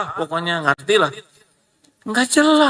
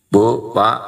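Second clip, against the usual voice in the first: ordinary talk, nothing marked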